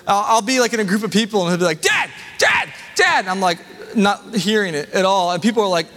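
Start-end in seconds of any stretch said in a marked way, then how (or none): 1.8-3.3 high-pitched imitation of a kid yelling for dad; high, insistent kid's call; five-year-old son calling. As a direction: mimic a small child calling out repeatedly. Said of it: "my five-year-old son's voice".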